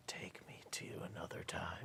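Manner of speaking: soft whispered wish; hushed, voiceless, playful incantation; mock-reverent whisper. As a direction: whisper a secret plea to a magic orb. Player mock-pleads with the orb.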